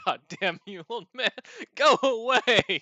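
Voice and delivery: high, drawn-out comic complaint; building to a loud, slow dismissal; mock exasperation. Younger lifter shooing the old man, mock exasperation.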